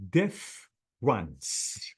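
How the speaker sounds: "difference" split slowly into diff, runs; two syllables. Shown as a demonstration. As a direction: break "difference" after diff, with a gap. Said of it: "we have two syllables".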